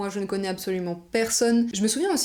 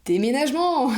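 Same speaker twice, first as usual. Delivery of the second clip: higher and louder, a punchy reveal; dramatic announcement; 5 semitones higher, 5 dB louder than usual. Real, not acted. Telling viewers the family is moving again, dramatic reveal. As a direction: land it like a big twist.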